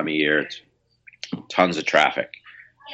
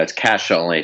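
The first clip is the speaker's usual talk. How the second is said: ordinary talk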